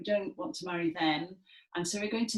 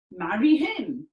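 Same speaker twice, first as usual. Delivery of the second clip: sneering phrase; 3 semitones higher, 7 dB louder than usual. Shown as a demonstration. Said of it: "as our phrase for Lysander".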